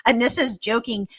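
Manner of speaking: usual speaking voice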